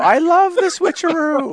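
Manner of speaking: high, loud, drawn-out glee; high squeaky excited. Pretend. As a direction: squeal the line with delight. Repeated in high, loud, slow voice.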